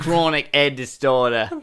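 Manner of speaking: put-on Aussie with dropped H in "head"; Australian accent. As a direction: say "Chronic head disorder" like an Australian. Title repeated in Australian accent.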